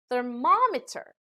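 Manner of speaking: "thermometer" with the weight on MOM; stress before ending. Shown as a demonstration. Teacher showing ending meter, "thermometer" stressed on MOM. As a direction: stress the second syllable of "thermometer". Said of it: "the syllable before these endings".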